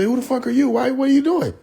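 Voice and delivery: high outraged mock yell at the toy washers; shocked high yell. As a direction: squeal it in disbelief. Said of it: "He said".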